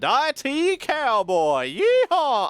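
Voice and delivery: high, loud hammed-up cowboy whoop; mock Texan cowboy yeehaw; cowboy accent. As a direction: holler it like a rodeo cowboy. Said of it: "I'm not gonna do that accent again".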